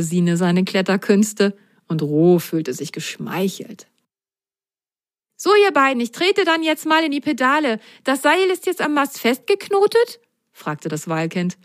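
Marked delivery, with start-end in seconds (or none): 5.4-10.2 put-on high, eager child voice full of questions; high curious kid voice; whale child voice. Whale child ready to pedal.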